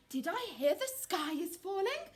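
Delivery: lightly quacked question; quacking duck. A narrator's reading. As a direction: ask it as a worried duck. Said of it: "quacked Ducky Lucky".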